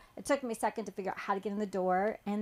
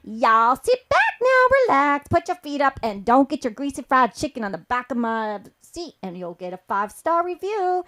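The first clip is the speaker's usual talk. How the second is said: Southern country driver telling you to relax; playful Southern country voice; Southern country accent; 3 semitones higher, 10 dB louder than usual. Retold or imitated. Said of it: "Southern country".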